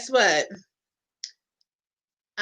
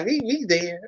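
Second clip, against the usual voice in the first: ordinary delivery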